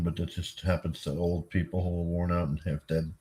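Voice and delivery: talking through a yawn; sleepy mid-yawn apology. Real, not acted. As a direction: yawn the words out, worn out. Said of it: "Sorry, I'm yawning".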